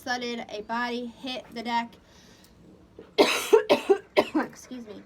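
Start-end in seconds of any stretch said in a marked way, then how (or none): 3.0-4.3 loud burst of coughing; real cough. Real, not acted. Reader interrupted mid-story, loud coughing. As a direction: cough hard several times.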